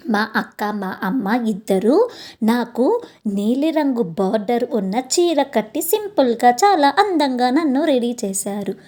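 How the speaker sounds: usual speaking voice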